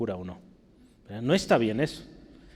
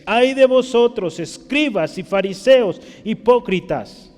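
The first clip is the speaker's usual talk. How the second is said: loud, raised-pitch scripture rebuke; forceful denunciation; 6 semitones higher, 7 dB louder than usual. Acted. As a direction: proclaim it hard, drawing out the opening woe. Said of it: "él habló fuertemente de estos".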